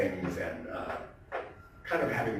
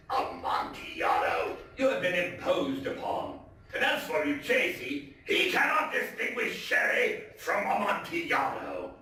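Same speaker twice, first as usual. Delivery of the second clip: scoffing Fortunato; 7 semitones higher than usual. Acted. Fortunato dismisses the cold.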